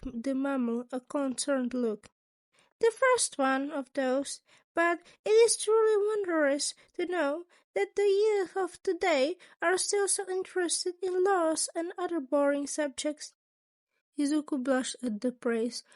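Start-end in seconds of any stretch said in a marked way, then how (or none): 2.8-13.3 high, bright-eyed principal voice, raised; a sly, chipper school principal asking; Nezu's voice. A narrator's reading.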